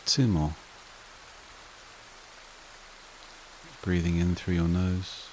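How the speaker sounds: soothing breathing-exercise delivery, spaced out and unrushed; guided meditation. Acted. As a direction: guide them gently into calm, phrase by unhurried phrase.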